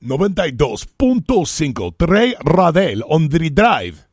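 slow, loud Latin DJ station ID; Spanish radio voice. Shown as a demonstration. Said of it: "That's my Spanish radio voice".

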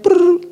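high brrr of cereal pouring; pouring sound effect. Retold or imitated. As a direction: mimic the cereal rattling in.

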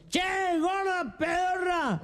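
high, drawn-out mock shout; calling up to balcony. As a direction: holler slowly upward like a rude drunk. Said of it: "Se para abajo del balcón y dice". (grito burlón, agudo y alargado)

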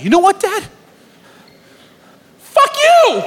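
put-on an eight-year-old exploding at his father; kid yelling at dad. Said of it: "I was like".

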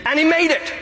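high-pitched, emphatic exclamation; triumphant. Real, not acted. Preacher exults that the unchurched thief made it, high and triumphant.

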